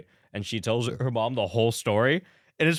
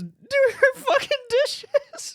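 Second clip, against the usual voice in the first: very high, very slow, stretched out in disbelief; incredulous squeal; 4 dB louder, much slower than usual. Real, not acted. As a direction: squeal the punchline. Incredulous punchline.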